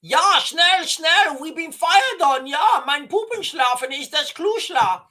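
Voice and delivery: high, loud mock-German panic; hammed-up German under fire, pitched high; German accent. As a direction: bark out cod-German in an urgent, high voice. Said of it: "after having a German accent".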